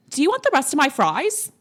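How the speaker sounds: put-on a hammy Australian accent. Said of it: "I tried to do an Australian accent".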